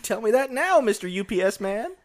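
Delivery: lightly voiced little kid; a hint of a small child. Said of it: "your little kid voice".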